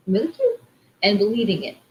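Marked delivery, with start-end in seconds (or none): 0.1-0.6 playful self-praise. Pretend. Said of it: "It's like saying".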